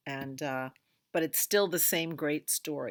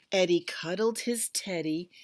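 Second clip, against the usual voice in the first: plain reading voice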